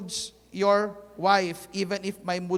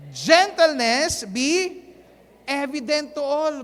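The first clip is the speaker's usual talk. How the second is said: slow, louder proclaimed reading of the verse; loud congregational reading; 5 semitones higher, 5 dB louder, slower than usual. Acted. Pastor leading the church in reading the verse, slow and loud. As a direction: call it out big so everyone reads along.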